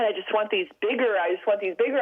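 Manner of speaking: ordinary talk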